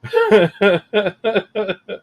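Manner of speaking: high giggling laugh; long chuckle at your own outburst; amused, rippling laughter. Real, not acted.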